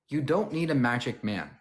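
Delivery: normal talking voice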